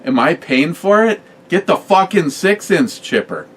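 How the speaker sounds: a hammed-up of-course yell; incredulous retold outburst. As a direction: blurt it like the answer is obvious. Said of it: "And I'm like".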